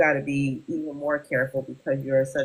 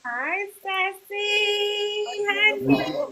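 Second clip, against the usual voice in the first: high, stretched-out sing-song greeting to a pet; much slower than usual. Real, not acted. Host coos a hello at Sassy the dog, high sing-song.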